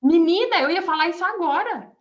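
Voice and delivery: excited exclamation. Real, not acted. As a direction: burst out amazed she said it first. Preacher reacts to congregant's verse.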